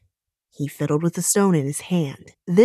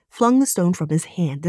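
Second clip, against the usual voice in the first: usual speaking voice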